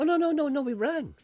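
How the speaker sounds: squeaky high protest; shrill no no, we rang; high squeal. Acted.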